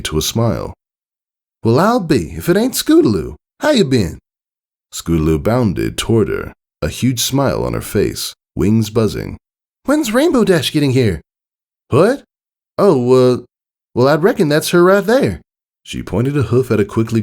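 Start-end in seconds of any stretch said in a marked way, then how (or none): 1.6-4.2 a pleased country welcome; Applejack greets Scootaloo in a country voice. A narrator's reading. 9.8-11.2 put-on high, eager kid question; high filly eagerly asking Applejack. A narrator's reading. Scootaloo bounding up eagerly. 11.9-15.4 high, louder country twang; startled country voice. A narrator's reading. Applejack caught off guard.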